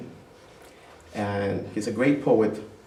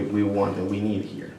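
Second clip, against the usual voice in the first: normal talking voice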